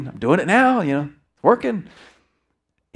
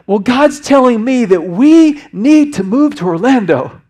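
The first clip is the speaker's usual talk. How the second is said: high, loud, slow and insistent; imitating a son pressing his dad; earnest son insisting; 8 semitones higher, 10 dB louder, slower than usual. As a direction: drive the point home as the impassioned son. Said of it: "He looks me in the eye and says".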